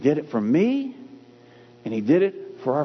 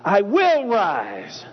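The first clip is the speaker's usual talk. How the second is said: WILL punched high and stretched; stress on WILL; 4 semitones higher, much slower than usual. Shown as a demonstration. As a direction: slowly, drive hard into WILL. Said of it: "you put that emphasis wherever you need it".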